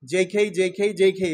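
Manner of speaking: জেই খেই said evenly, rising on খেই; teacher modelling জেই খেই for the class; model rhythm. Shown as a demonstration. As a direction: drill জেই খেই with a rise on খেই. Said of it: "এভাবে বলো তো সবাই".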